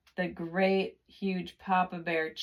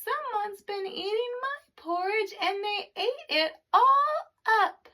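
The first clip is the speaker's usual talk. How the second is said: put-on much higher, small squeaky baby bear; Baby Bear's tiny voice; 14 semitones higher than usual. A narrator's reading. Narrator gives Baby Bear's upset porridge line, high and tiny. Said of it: "in his wee small voice he said".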